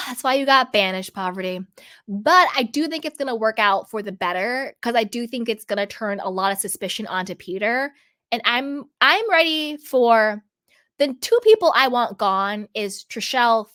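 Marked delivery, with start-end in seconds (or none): none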